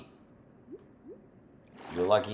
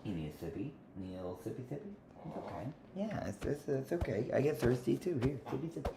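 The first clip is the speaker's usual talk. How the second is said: put-on baby talk; 5 semitones higher, 10 dB quieter, faster than usual. Cooing to a pet with a drink.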